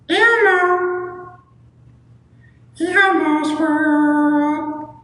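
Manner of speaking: squeaky, very high puppet falsetto; Elmo. Acted. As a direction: sing it out in a tiny high voice, drawn out. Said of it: "Oh, we do Elmo".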